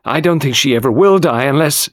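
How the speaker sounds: high, agitated, stumbling into a tirade; overwrought, insistent protest; sudden outburst. A narrator's reading. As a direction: burst out and keep the pitch up. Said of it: "Then she broke out suddenly".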